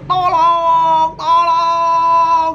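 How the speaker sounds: loud, high-pitched drawn-out cries; child's screams for help. Retold or imitated. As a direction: holler like a terrified kid in a cinema. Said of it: "teriakan saya yang masih kecil". (teriakan melengking anak kecil)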